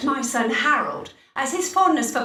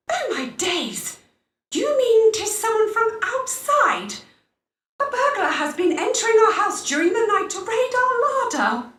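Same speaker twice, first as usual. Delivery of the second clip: alarmed; 8 semitones higher than usual. Acted. Alarmed by bad tidings.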